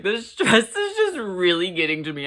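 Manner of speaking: high, loud mock outburst; imitated freak-out. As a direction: blurt it out loudly as if cracking up. Imitates Jinx freaking out on stage.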